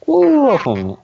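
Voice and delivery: shrieking laugh over words; laughing, very high. Real, not acted. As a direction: squeal it while laughing. Laughing outburst.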